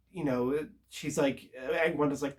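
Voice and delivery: ordinary delivery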